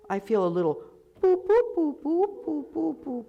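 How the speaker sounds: high bouncy boop sounds. Shown as a demonstration. Director showing the vocal tract shape, high boops. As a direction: bop out little boops. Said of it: "What shape do you feel?".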